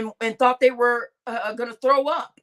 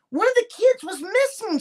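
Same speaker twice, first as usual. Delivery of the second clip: higher voice, lightly imitating a frantic mom; worried mother quoted; 9 semitones higher than usual. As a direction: quote her alarm with a lifted pitch. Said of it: "she said".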